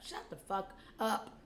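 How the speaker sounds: usual speaking voice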